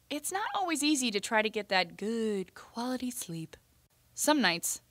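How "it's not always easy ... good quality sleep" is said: hushed whisper. Acted. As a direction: whisper it like it's bedtime. Sleepless worry, whispered aside.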